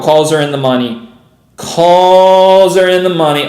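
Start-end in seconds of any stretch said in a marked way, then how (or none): 1.6-3.5 loud, drawn-out elated announcement; real excited call, slow and loud; excited exclamation. Real, not acted. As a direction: stretch the first word out loud in excitement. Repeats the news, excited.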